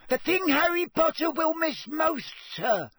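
squeaky, high-pitched insistent elf; Dobby. A narrator's reading. Elf insisting Harry can do the task, squeaking. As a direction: squeak it with utter conviction. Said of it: "squeaked Dobby".

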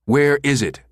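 insistent demand for the ring's location. A narrator's reading. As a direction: press him hard for an answer. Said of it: "Becker demanded".

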